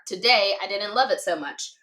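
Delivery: ordinary talk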